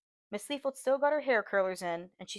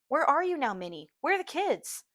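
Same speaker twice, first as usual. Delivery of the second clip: alarmed; 6 semitones higher, 4 dB louder than usual. A narrator's reading. Aibileen alarmed on phone.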